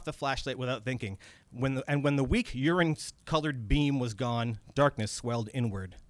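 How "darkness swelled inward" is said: ordinary delivery, nothing marked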